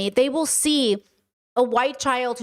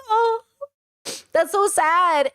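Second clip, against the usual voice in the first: pitying coo; 7 semitones higher, much slower than usual. Retold or imitated. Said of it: "And I'm like".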